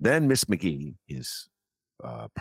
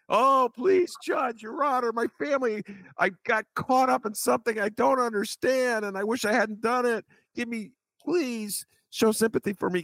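high, whiny mock plea to the judge; mock pleading defendant. Host mocks the convict begging the judge, in a high whine. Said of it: "in court today".

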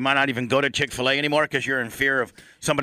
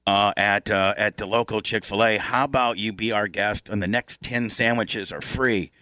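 lightly put-on corporate apology voice; mock corporate apology; 7 semitones lower than usual. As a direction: play the apologetic company rep.

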